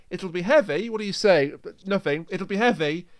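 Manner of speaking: ordinary delivery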